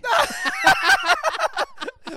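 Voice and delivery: loud high laugh; burst of laughter. Real, not acted. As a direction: laugh out hard. Laughing at being teased.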